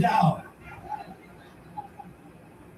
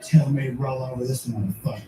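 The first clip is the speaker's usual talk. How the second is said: low threatening cat voice; menacing cat; 7 semitones lower than usual. Acted. Cat threatens owner in bit.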